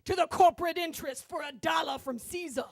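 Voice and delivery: fire-and-brimstone preacher, stretching the stressed words; booming sermon voice. Retold or imitated. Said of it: "continues his sermon".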